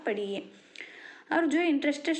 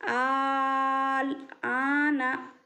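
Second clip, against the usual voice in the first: flowing slow recitation; much slower than usual. Shown as a demonstration. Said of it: "एक बार रवानी पढ़ लेंगे".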